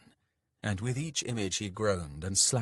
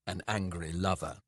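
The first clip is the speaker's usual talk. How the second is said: ordinary delivery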